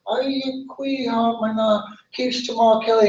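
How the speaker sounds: a hint of a little bird calling up politely.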